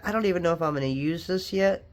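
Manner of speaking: ordinary talk, nothing marked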